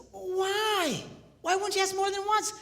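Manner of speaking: high-pitched mock bafflement; incredulous. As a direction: plead for an explanation in a high voice. Acting out his amazement at her answer.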